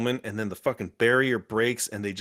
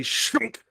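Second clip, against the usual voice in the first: sharp high "shunk" of impalement; sudden high-pitched impact noise; imitated stabbing noise; 12 semitones higher than usual. Fan imitating rebar going into Gambit, sudden stab noise.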